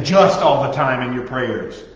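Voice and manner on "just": "just" hit hard and loud; stress on just. Shown as a demonstration. Preacher singling out the word "just", loud. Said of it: "stop saying".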